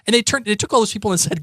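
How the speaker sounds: usual speaking voice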